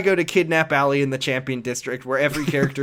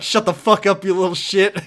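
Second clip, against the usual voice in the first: louder and higher, mock angry snap; mock yell; 6 semitones higher, 6 dB louder than usual. Kiryu telling off the spoiled kid, mock angry yell. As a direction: tell the brat off, half-laughing. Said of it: "he's just like".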